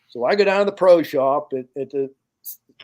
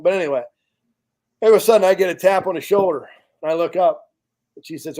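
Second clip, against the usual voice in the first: ordinary delivery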